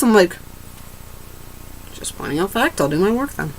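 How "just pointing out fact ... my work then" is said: soft, grudging mutter; sullen mutter. Retold or imitated. Student muttering back to the threatening teacher. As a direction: mumble it, giving in with attitude.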